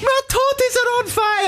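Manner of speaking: squealing falsetto Scottish nanny; hammed-up, hysterical high impression; Mrs Doubtfire. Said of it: "a bad Scottish accent".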